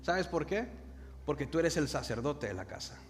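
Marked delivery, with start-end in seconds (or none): none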